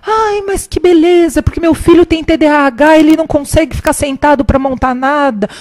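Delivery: high, loud mock gushing lament; loud complaining mother. Mother going on about her son in the shop, loud and high. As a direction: imitate a mother broadcasting her woes to the shop. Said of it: "bem alto".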